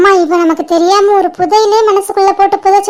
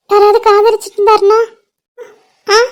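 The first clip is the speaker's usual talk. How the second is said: ordinary delivery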